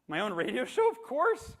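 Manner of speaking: bursting with excitement; thrilled. Retold or imitated. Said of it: "I'm on cloud nine".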